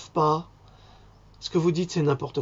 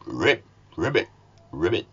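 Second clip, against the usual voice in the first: low croaking ribbit, dropping lower; frog croak; 9 semitones lower than usual. Retold or imitated. Imitating the toads behind the devil with low croaks. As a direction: croak it out like a swamp toad. De crapauds.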